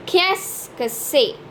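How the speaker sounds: Qu'est-ce que c'est slowly in three syllables, "kess-kuh-seh"; slow pronunciation model. Shown as a demonstration. Teacher for learners to repeat, Qu'est-ce que c'est slowly as "kess-kuh-seh". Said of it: "learn to pronounce this phrase".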